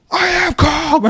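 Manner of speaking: very high, strained mock yell; uncalm voice. Jet skier protesting to the worker, imitated uncalm voice. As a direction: imitate someone insisting on calm while worked up. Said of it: "With his uncalm voice".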